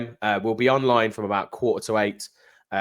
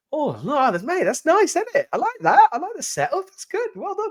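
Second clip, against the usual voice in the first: delighted; 3 dB louder than usual. Real, not acted. Admiring the setup.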